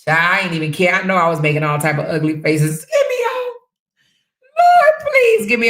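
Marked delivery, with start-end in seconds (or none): 2.8-5.7 high, squealed retold plea; mock scream. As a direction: beg in mock panic, starting very high then coming down. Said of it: "I was screaming louder than them".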